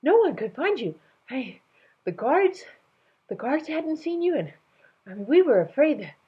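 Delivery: high, flustered rush. Acted. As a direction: babble nervously. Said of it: "the voice stuttered".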